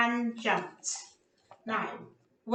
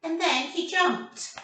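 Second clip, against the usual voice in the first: ordinary delivery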